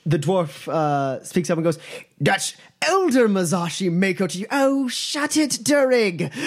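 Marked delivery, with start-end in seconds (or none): 2.2-4.5 lightly voiced dwarf correcting sharply; a touch of an indignant dwarf; dwarf snapping a correction; gruff dwarf. 4.5-6.3 high, slow mocking voice; a high mocking character telling the dwarf off; mocking dismissal.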